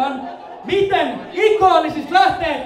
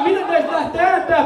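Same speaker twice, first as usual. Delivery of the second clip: ordinary talk, nothing marked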